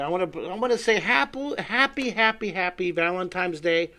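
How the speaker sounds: high, loud, fast happy Valentine's wish; excited greeting. Real, not acted. Wishing viewers happy Valentine's Day, high excited greeting.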